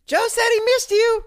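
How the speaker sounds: high, loud mocking shout; Annalise teasing. Girlfriend teasing him in a loud high shout. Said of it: "last second Annalise goes".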